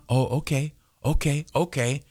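hushed yes-man nodding along; absent-minded agreement. Pretend. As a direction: agree quietly without listening. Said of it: "Not hear a word".

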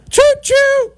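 high, loud choo-choo call; gleeful train whistle. Pretend. Express train to Super Bowl.

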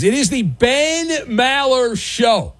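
high, drawn-out show announcement; show announcer. Real, not acted. Radio host announces show name.

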